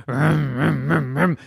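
loud vocal vroom bursts; engine revving noise. Acted. Rocky revs in the jar, engine noise.

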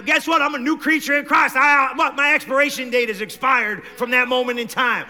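loud, high, shouted preaching; full-force preaching. Acted. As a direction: preach it out at the top of your voice.

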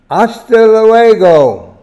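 put-on "Hasta luego" in a loud, high American accent, stress on "luego"; a cheerful American goodbye in Spanish, "luego" said as "lu-ei-go". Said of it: "in my American dialect".

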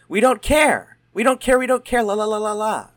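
high, loud sing-song taunt; mock sing-song shout. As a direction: chant it loud like a playground tease. Teasing sing-song refusal.

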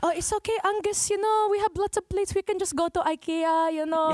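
put-on high Filipino mom accent, cheerful and doting. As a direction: recreate mom's Filipino accent, sweet and insistent. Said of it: "you want me to recreate it?".

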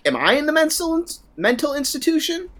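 high-pitched, incredulous question; bewildered questioning. Retold or imitated. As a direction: act out a viewer doubting their own sanity. Acts out a bewildered viewer.

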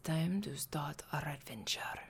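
hushed, slow whisper; whispered intro. Acted. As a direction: whisper the show open softly.

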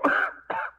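harsh, painful coughing fit; racking cough. Acted. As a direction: cough hard from the chest. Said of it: "haunted by a racking cough".

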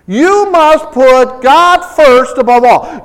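ordinary talk

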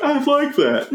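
put-on high, sweet, gullible little kid voice; mock naive kid. Rowley accepting Greg's invite, high gullible chirp. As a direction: chirp it high like an eager child. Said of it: "Rowley's like".

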